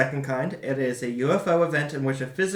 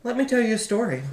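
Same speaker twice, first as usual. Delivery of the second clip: raised, quick storytelling intro; storyteller announcer; 8 semitones higher, faster than usual. Acted. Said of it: "Well, folks".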